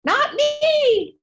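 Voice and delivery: very high, loud, drawn-out wail; mock panicked protest. Teacher acting someone struggling with their last breath, mock panic. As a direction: cry out in hammed-up refusal.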